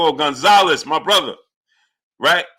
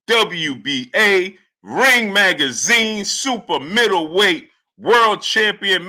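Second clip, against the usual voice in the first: ring announcer; 2 semitones higher, slower than usual. Shown as a demonstration. Streamer showing how the announcer should have introduced Canelo. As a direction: stretch each title, then belt the name. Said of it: "I would have said like this".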